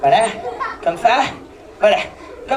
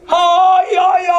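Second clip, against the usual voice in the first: loud yelped aïe aïe aïe; war cry demo; 3 semitones higher, 4 dB louder, much slower than usual. Shown as a demonstration. Clown showing kids the cri qui tue, loud yelp. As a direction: show the kids the killer yell. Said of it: "le cri qui tue fait comme ça".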